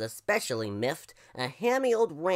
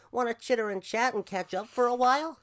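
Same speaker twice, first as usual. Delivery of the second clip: higher, chummy crow voice; smiling crow; 8 semitones higher, 3 dB louder than usual. Acted. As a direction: invite a pal to chat, with a smile. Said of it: "said the crow with a smile".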